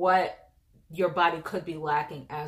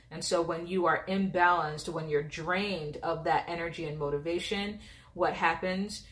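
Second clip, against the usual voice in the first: ordinary talk, nothing marked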